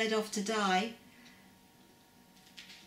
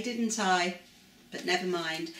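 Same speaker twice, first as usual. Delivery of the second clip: ordinary delivery, nothing marked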